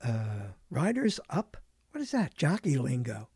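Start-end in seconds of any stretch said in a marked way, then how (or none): none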